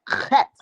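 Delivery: "cat" opened with a throaty scrape; guttural Hebrew sound. Shown as a demonstration. Repeating the nasty Hebrew word, guttural "cat". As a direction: rasp the start of "cat" from the throat. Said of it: "you gotta get that".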